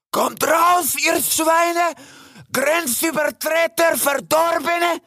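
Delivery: very high, drawn-out furious yell; raving stag, screeching high; raging shout. A narrator's reading. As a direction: rage at the hidden intruders, stretching the insults. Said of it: "rief der Hirsch und rupfte tobend".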